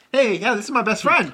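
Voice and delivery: high, loud imitated friend; retold happy exclamation; enthusiastic French friend. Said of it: "the French dude's like".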